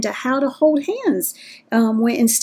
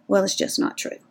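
normal talking voice

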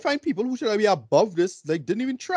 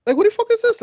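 incredulous outburst; 4 dB louder than usual. Real, not acted. Outraged at bad accents.

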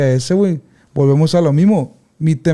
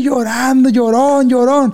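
high sing-song teasing of a crybaby; mocking taunt; 7 semitones higher, slower than usual. Rival team's mom taunting the crying boy, mock jeer. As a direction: mimic the rival mom jeering at a kid. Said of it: "pinche doña, güey, abusona".

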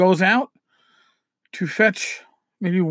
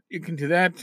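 usual speaking voice

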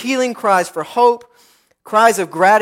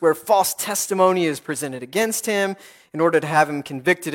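normal talking voice

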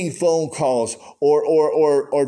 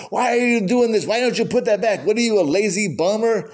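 retold rant, pitched higher; mock tirade at someone's face; 5 semitones higher than usual. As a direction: imitate a man scolding shoppers, raised pitch. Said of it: "he just rants and raves at their face".

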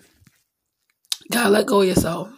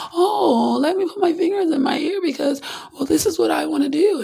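whiny stubborn voice; 10 semitones higher than usual. Retold or imitated. Mocking stubborn listener.

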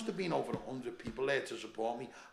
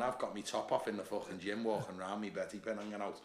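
normal talking voice

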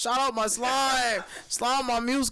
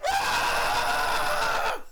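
hype shout; 8 semitones lower than usual. Acted. Countdown end shout.

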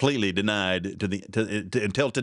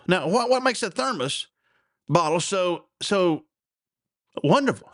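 mock curious interviewer, pitched up; 8 semitones higher than usual. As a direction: chipper survey guy, puzzled. Interviewer voiced in joke.

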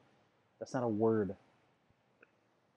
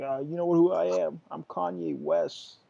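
[name] impression; 4 semitones higher than usual. Imitating [name] refused entry.

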